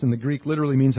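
normal talking voice

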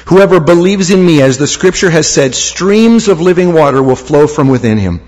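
normal talking voice

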